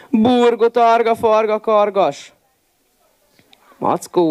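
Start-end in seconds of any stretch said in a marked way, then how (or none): 0.1-2.3 wolf yelling the spell while fleeing stung by bees; shouted spell. A narrator's reading. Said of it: "egyre jobban csak kiabálta".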